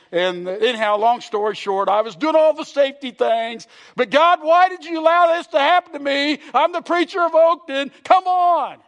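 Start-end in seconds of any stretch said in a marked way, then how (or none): 4.0-8.8 higher and louder, griping at God; hammed-up indignant whine; mock whiny complaint. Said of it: "That isn't how I responded".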